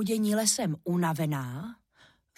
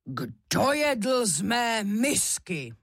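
slow, drawn-out roar of a papa bear; silný medvědí hlas; 3 semitones higher, much slower than usual. A narrator's reading. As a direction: bellow slowly like a big bear. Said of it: "zařval silným medvědím hlasem". (řev silným medvědím hlasem)

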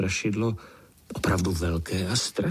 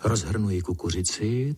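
plain reading voice

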